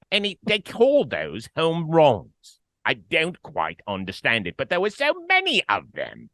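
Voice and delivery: put-on London accent, half-hearted; mock posh English voice; British accent. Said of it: "Can you give me your London accent real quick?".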